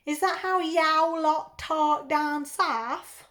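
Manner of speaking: slow, put-on South London accent; drawn-out parody of southern English talk; mock southern accent. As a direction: tease back with an exaggerated London accent. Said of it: "I apologise now for my accents".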